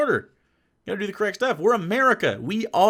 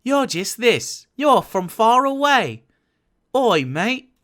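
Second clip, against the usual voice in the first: slow, higher, exaggerated British accent; mock British accent; 5 semitones higher, 3 dB louder, slower than usual. Streamer showing the British how they all sound, mock British accent. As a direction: draw out a lazy parody of British speech. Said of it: "do your real accent".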